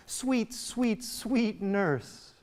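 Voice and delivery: put-on high-pitched young girl's wheedling; begging sweetly. Young Juliet begging the nurse for something, sweetly pleading. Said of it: "Juliet is a young girl begging for something".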